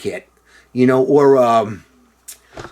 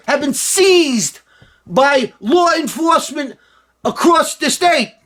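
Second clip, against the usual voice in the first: much higher, slow, shouted New York politician voice; hammed-up New York Cuomo yelling the press release; Cuomo accent; 12 semitones higher than usual. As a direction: go big with the mock Cuomo impression. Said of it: "can I do his accent again".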